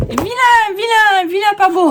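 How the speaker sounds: a playful tiny tot's voice; mock baby talk. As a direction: scold the paper like a cross little child. Mocking baby-talk verdict on ugly paper.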